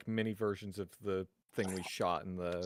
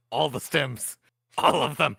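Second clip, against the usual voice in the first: gleeful; 3 semitones higher, 8 dB louder than usual. Real, not acted. Greedy grab of all stems.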